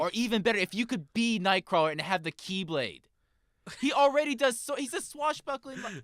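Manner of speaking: high-pitched, loud fanboy excitement; excited gushing. Real, not acted. Gamer pitches his dream character to his co-hosts, excited gushing.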